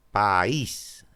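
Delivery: "País" drawn out as "pa-ÍS"; "País" slowly, rising onto a long "ís"; hiatus in two syllables. Shown as a demonstration. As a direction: hold the "a" and "í" of "País" apart. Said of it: "Dos sílabas".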